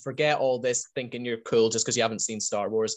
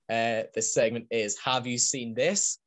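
normal talking voice

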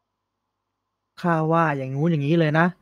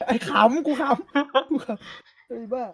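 laughing; 7 semitones higher, 5 dB quieter, much slower than usual. Real, not acted. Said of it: "อันนี้ขำ".

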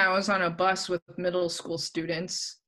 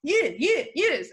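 put-on high Russian-accented yeses; quick insistent Russian driver, pitched up; 9 semitones higher, 4 dB louder, slower than usual. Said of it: "person that was Russian".

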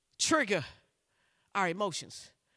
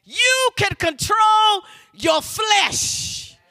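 full-force preaching. Real, not acted. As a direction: drive it home to the congregation.

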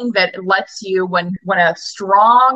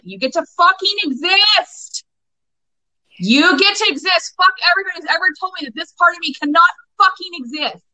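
high, forceful declaration; yelled defiance; 10 semitones higher than usual. Shown as a demonstration. Coach demonstrating a defiant yell of self-acceptance, high pitched. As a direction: yell it like you finally believe it. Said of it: "Fucking yell it".

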